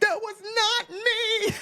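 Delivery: high, drawn-out, aghast protest; imitated emphatic denial. Producer denying the prank to host, mock aghast. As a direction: stretch it out as an incredulous denial.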